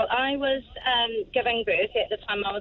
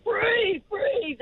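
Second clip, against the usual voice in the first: urgent; 10 semitones higher than usual. Retold or imitated. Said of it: "I hear them saying".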